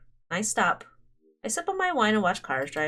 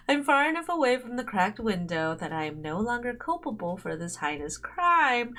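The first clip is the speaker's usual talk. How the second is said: normal talking voice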